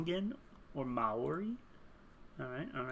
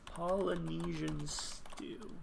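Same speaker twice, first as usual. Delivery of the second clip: slow, soft POLYNESIAN STEW; drawn out; 7 dB quieter, much slower than usual. Real, not acted. Player to self while typing a search, drawn out. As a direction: stretch out every syllable of POLYNESIAN STEW.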